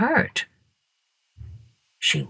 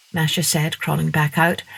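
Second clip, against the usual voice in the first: normal talking voice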